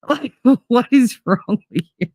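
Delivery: normal talking voice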